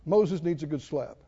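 usual speaking voice

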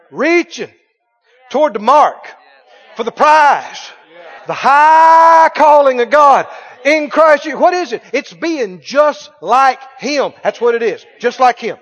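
emphatic preacher, building to a shout and drawing out key words; forceful preaching. Real, not acted. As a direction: proclaim it phrase by phrase to the congregation.

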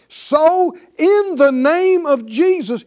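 slow, high, loud proclamation; forceful invocation. Shown as a demonstration. Believer invoking the name against evil, forceful proclamation. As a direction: declare it with full authority, drawing it out.

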